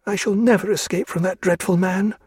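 high, despairing woman's voice; a high lament of dread; Diana's voice. A narrator's reading.

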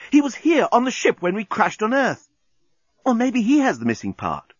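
high-pitched, eager explaining; excited exclamation. A narrator's reading. Journalist piecing it together aloud, excited. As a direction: blurt out the realisation high. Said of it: "exclaimed the journalist".

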